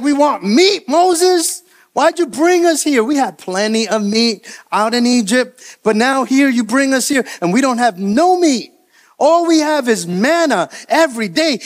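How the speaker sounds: high-pitched, whiny crowd protest; crying-out complaint. Acted. The people complain to Moses about having no meat, crying out. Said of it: "they're crying out".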